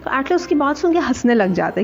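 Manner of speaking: ordinary talk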